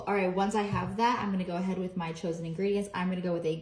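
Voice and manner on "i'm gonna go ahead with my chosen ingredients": ordinary delivery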